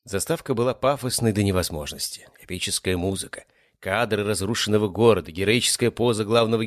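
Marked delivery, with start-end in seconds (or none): none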